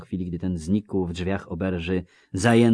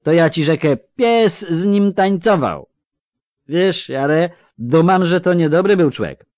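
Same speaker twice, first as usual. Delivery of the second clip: loud, higher, slurring tipsy villager; drunk Melfi; 12 semitones higher, 12 dB louder than usual. Acted. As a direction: call out like a cheery drunk. (pijany Melfi, głośno i bełkotliwie)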